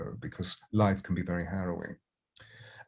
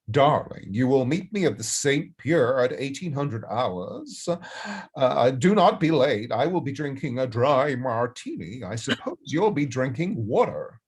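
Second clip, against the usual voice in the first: put-on Katharine Hepburn accent; 5 semitones higher, 4 dB louder than usual. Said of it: "she has a sort of Katharine Hepburn".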